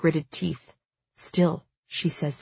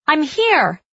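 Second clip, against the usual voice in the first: high, loud answering call; shouting back; 10 semitones higher, 5 dB louder than usual. A narrator's reading. Sister at the bottom of the stairs answering her brother, shouting. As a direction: call out urgently to someone upstairs. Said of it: "she shouts back".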